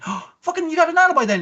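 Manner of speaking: high-pitched exasperated Yuki Onna; mock sarcastic squeal. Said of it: "And she's like".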